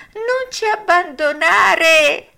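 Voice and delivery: very high, loud, slow wail; whining fox voice. A narrator's reading. Fox wailing for pity at Pinocchio. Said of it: "voce di piagnisteo".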